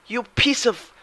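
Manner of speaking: very high, loud, slow rage at the stock; furious outburst. Real, not acted. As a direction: scream it at the chart, building. Trade rejected at three ninety-nine.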